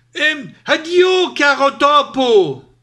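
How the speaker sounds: high, loud, stretched-out farewell; hasty exclamation. A narrator's reading. Fox bolts from the monster, exclaiming loudly. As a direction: cry goodbye in alarm. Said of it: "d'un fiato esclamò".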